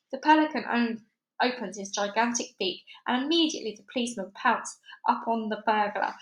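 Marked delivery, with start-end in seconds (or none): none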